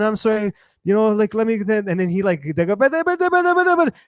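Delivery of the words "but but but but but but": high-pitched gibberish imitating Spanish; mock Spanish chatter. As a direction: babble nonsense as if it were Spanish. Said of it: "that's not how Spanish sounds like".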